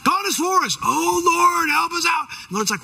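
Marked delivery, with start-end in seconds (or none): 0.0-0.8 high, projected declaration; confident public front. Acted. Believer putting on confidence before others, boldly declaring. 0.8-2.3 high, mock desperate wail to heaven; crying out. Panicked leader begging the Lord for help, crying out. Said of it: "why do you cry out to me?".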